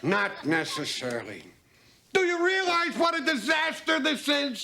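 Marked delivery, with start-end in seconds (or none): 2.1-4.6 a frantic teacher shouting at her colleague, high panicked yell; screaming in panic. Real, not acted. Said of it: "if you stop screaming".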